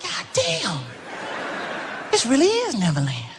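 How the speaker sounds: amazed. Retold or imitated. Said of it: "You be like".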